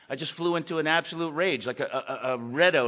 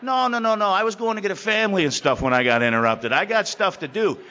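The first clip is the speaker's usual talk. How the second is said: louder, higher protest; loud refusal; 5 semitones higher, 8 dB louder than usual. Retold or imitated. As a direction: push back hard, no no no. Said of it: "And I said".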